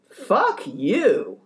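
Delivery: hint of hostile retort; mock curse back. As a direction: snap it back at him. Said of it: "A normal person goes".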